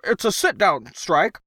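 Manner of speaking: high, anxious little voice; nervous protest. A narrator's reading. Crockett explaining the strike to his ruler, nervous protest. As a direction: press on timidly at a high pitch. Cringing Crockett confronts emperor.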